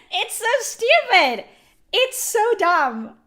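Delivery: loud, high exasperated outburst. Real, not acted. Streamer ranting at chat, loud exasperated outburst. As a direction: throw it up high in disbelief. Ranting about ban punishment.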